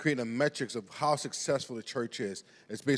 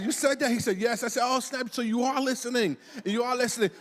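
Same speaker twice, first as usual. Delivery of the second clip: high-pitched astonished dad; amazed; 7 semitones higher than usual. Retold or imitated. Dad finds his son was listening, amazed. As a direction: retell it with rising, delighted disbelief.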